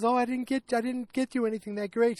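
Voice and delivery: high-pitched imitation of a sheepish friend; apologetic friend. Holly admitting her gift is smaller, apologetic. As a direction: play down your gift in a high voice.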